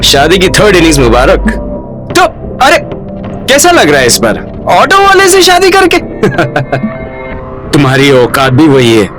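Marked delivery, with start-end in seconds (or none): 3.5-6.0 high, swooping jeer; mocking taunt. Man jeering at woman's marriage to auto driver, mocking taunt. 6.0-7.7 mocking laugh. Man laughing at the woman he taunts, mocking chuckle.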